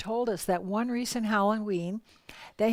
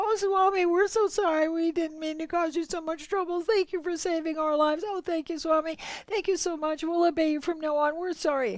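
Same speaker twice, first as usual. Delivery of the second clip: high, pleading apology; the scolded couple apologises to Swami, high and pleading; contrite apology; 8 semitones higher than usual. Retold or imitated. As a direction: beg forgiveness in a high voice.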